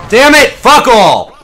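loud burst of swearing; frustrated shout. Real, not acted. Gamer cursing a bad moment in the match, frustrated shout. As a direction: curse it out at full voice. Frustrated outburst during the game.

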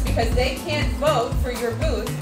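ordinary delivery

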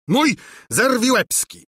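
high, loud furious cry; king bursting with rage. A narrator's reading. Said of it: "zgrzytał zębami z wściekłości". (wściekły okrzyk króla)

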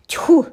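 loud high disgusted ptui; spit. A narrator's reading. Dragonling spitting at the silly names, loud.

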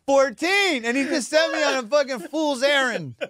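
high, incredulous telling; amazed at being fooled. Real, not acted. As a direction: pitch it up in disbelief. Indignant retelling, raised voice.

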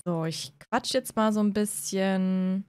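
usual speaking voice

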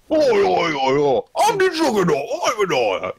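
a light Cockney accent, hint of a hard man; Cockney Vinnie Jones impression. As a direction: play Vinnie Jones with a slight Cockney swagger. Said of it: "that impression was so complete".

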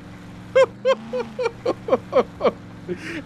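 long, high giggling fit; helpless high laughter releasing the panic; high-pitched laughter. Real, not acted. Player relieved after a scare, high nervous laughter.

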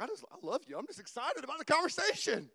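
building to a high, loud mock yell; imitated yelling. Husband retelling how he yelled at his wife, comic excited yell. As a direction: protest lovingly, rising into a comic shout. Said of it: "I would just yell all the time".

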